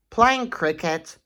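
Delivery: usual speaking voice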